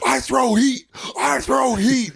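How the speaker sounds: high, yelled boast; yelling Will Ferrell bit. Retold or imitated. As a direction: bellow it like a cocky pitcher. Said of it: "He was just, like, yelling it".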